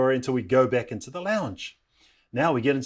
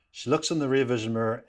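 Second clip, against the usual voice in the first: ordinary talk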